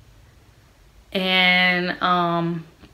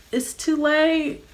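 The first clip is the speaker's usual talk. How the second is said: high, slow singsong refusal; imitated doctor; 9 semitones higher, much slower than usual. Doctor turning down laboring patient's epidural plea, imitated high and slow. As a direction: draw it out high, as a mock doctor.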